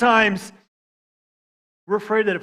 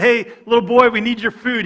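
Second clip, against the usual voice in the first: put-on calling to the boy; 5 semitones higher, 9 dB louder than usual. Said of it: "they came and said".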